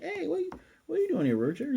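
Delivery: bright surprised call to a friend; Eris, spotting Ruijerd, greets him very high and fast; surprised greeting. Retold or imitated.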